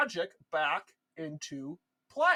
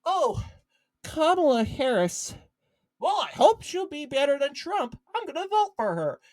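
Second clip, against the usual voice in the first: higher-pitched, lightly mocking voter voice; lightly hammed-up enthusiasm; mock naive voter; 6 semitones higher than usual. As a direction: imitate an easily convinced voter. Mimics naive voters choosing Harris.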